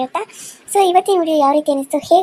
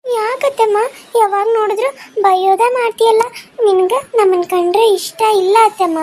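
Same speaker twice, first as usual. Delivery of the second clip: put-on high child voice, complaining; hurt little girl; 7 semitones higher, 4 dB louder than usual. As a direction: protest to aunt like a wounded child. (ಅತ್ತೆಗೆ ದೂರುವ ಮಗುವಿನ ಧ್ವನಿ)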